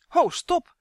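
high, loud shout to halt them; alarmed yell. A narrator's reading. Justin stops guards taking the elf, high alarmed yell. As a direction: yell out urgently. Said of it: "gilde Justin".

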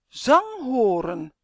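sepulchral voice. A narrator's reading. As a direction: boom it slowly like a spell from the tomb. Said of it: "met een grafstem". (langgerekte, luide grafstem)

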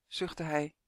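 ordinary delivery

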